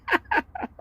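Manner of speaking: short high-pitched giggle; high laugh. Real, not acted. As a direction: let out a squeaky chuckle. Laughs after telling stories.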